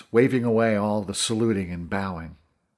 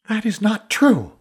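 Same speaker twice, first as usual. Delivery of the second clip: high, loud, indignant denial; angry denial; 11 semitones higher, 5 dB louder than usual. Acted. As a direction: reject it fiercely, voice pitched well up. Essa rejects news of betrayal.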